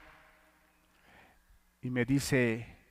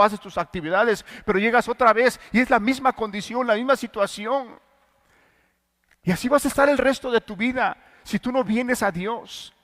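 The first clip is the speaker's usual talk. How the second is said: louder, raised preaching voice pleading for repentance; fervent preaching; 7 semitones higher, 7 dB louder than usual. Acted. A preacher urging his listeners toward true repentance, fervent and loud. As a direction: preach it out, urgent and insistent.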